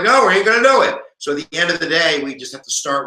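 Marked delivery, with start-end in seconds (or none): 0.0-1.0 raised, louder mock refusal; defiant refusal. Rhode Island refusing the other states, defiant. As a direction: refuse like a stubborn holdout.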